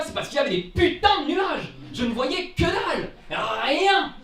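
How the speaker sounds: high, loud comic complaint; hammed-up indignant outburst; exasperated despair. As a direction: wail it in outraged frustration. Comic despair over the clouds.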